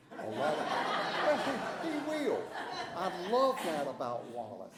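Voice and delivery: loud laugh breaking through the words. Real, not acted. Speaker to the meeting, laughing loudly about Wallace crying. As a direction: laugh out loud while teasing a friend.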